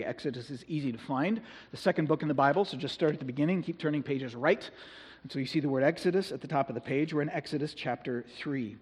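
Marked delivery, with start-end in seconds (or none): none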